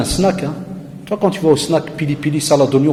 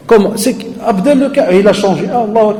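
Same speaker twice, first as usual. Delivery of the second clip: mock surprise; 7 semitones higher, 3 dB louder than usual. Neighbours gossip in surprise.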